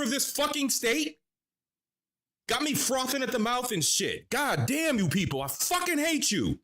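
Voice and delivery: high-pitched enraged tirade; frothing at the mouth at politicians; real furious rant. Real, not acted. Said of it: "Sorry, y'all, I got mad there".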